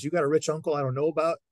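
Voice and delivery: a dad scoffing at the tuition; teasing dad. Retold or imitated. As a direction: needle the kid about the money. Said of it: "he goes".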